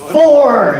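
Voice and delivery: high, drawn-out yell; golf warning yell. Shown as a demonstration. Golfer names the word to yell, high drawn-out shout. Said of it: "is the word you're supposed to yell".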